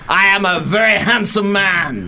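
high, loud smug Frenchman; French accent. Pretend. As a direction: say it like a vain charmer. Henry impression continues.

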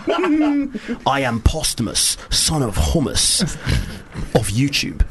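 slow, grand Viking boast through laughter. Pretend. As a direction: declare your name like a Viking chieftain. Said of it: "Sounds like a Viking".

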